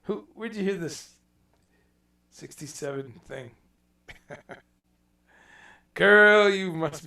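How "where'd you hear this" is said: usual speaking voice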